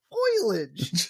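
"Foliage" insisted on as FOY-lij; mispronounced foliage. Retold or imitated. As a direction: repeat the botched "Foliage" as if correct. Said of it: "That's what I said".

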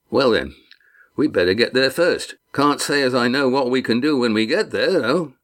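lightly voiced rough sailor, decided; a hint of a homespun deckhand, firm; Walt, resolute sailor. A narrator's reading.

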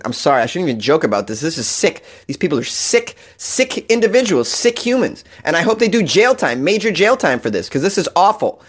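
ordinary talk, nothing marked

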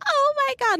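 high, shocked Oh my God; stunned gasp. Retold or imitated. Chloe realizing Trevor is a girl, shocked. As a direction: squeak it out in shock.

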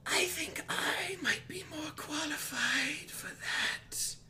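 slow high whisper from everywhere; eerie whisper. Acted. Mysterious god speaking to the party, slow eerie whisper. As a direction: breathe it out like a voice from the walls. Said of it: "you hear another voice".

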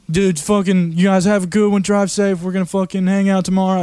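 imitating friend's goodbye. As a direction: put on a high, casual buddy voice. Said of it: "he's like".

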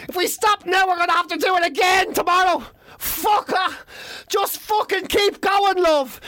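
high, strained yelling; mock desperate insistence. Man urging his partner to keep going, hammed-up desperation. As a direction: push it out high and exasperated. Acted refusal to stop.

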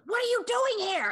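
high, loud surprised squeal; surprised fan. Retold or imitated. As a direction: burst out in surprise. Fan surprised to see her.

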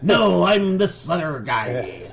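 high, drawn-out goofy denial; exaggerated denial. Exposed pirate bluffing to his victim, high drawn-out denial. Stede acted denying his identity.

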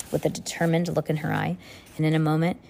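ordinary delivery

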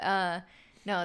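ordinary talk, nothing marked

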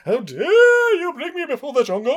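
hammed-up stage declamation; mock Shakespearean actor.

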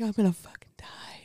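whisper. Retold or imitated. Retold panic on railing.

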